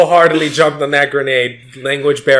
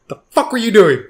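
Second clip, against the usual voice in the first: higher-pitched imitation of an annoyed mother; mock scolding mom; 7 semitones higher than usual. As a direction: retell a wife's exasperated scolding. Mom retold scolding the dad.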